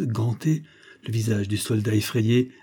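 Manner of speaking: ordinary delivery, nothing marked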